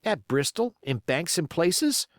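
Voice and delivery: put-on high, doubtful young seaman; young sailor. Young seaman doubting Silver's money, high. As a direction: push back, eager and high.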